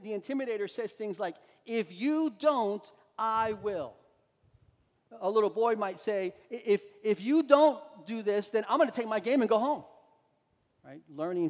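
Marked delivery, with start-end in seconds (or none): 1.7-3.9 threatening. Acted. Intimidator voicing a threat. 6.5-9.8 put-on little boy threatening.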